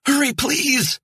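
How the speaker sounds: very high, loud urgent plea; frantic high yell to the hiker; urgent shout. Acted. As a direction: shout at him to hurry. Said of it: "she shouted".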